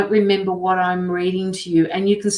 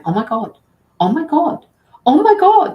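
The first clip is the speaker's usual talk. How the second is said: each oh my God higher and louder, rising into a shriek; building excitement; 9 semitones higher, 8 dB louder than usual. Retold or imitated. Retelling the friend seeing the woman, building excited shrieks. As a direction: start amazed and climb to a squeal. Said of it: "and she was going".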